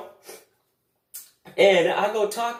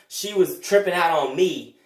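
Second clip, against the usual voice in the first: normal talking voice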